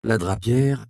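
usual speaking voice

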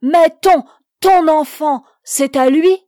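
loud, high cry of shock; anguished cry. A narrator's reading. Jeanne learning who the child's father is, crying out. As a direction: cry it out, breaking off on the first words. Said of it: "poussant un cri".

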